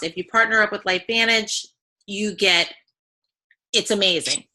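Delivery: ordinary talk, nothing marked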